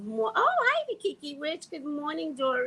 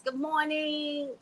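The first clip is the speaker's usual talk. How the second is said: slow host greeting; 6 semitones higher, slower than usual. Shown as a demonstration. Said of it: "not getting the intonation".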